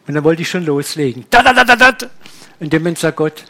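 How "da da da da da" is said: eager rush. Retold or imitated. Eager preacher about to launch in.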